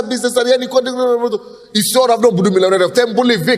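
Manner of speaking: ordinary talk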